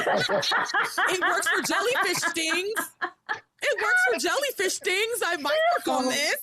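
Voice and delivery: high, laughing through the words; giggling squeaky joke. Pretend.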